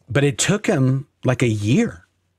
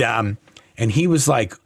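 ordinary delivery

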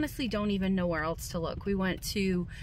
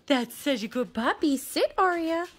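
high sing-song dog praise; squeaky praising a dog; 5 semitones higher than usual. Real, not acted. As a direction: coo at the puppy. Praising puppies.

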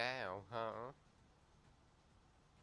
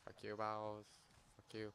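normal talking voice

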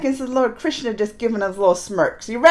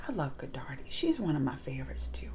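soft fond whisper; a tender secret whispered to the viewers; 11 semitones lower, 16 dB quieter than usual. Real, not acted. Fond aside about a favorite.

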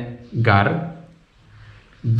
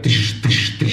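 single gunshot imitation. Shown as a demonstration. Guest imitates single gunshots, hissed tish. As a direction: hiss short single shots. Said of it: "if it's one".

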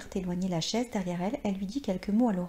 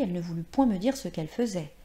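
plain reading voice

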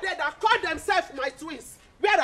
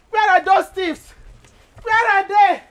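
loud, furious calling out; angry shout; 5 semitones higher, 11 dB louder than usual. Acted. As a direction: bellow for the thieves across the yard. Woman hunting the goat thieves.

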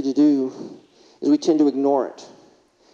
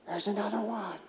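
put-on softer, higher kid's voice, a little awed; a child murmuring in wonder out the window; hushed excited kid; 6 semitones higher, 14 dB quieter than usual. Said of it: "he's excitedly saying".

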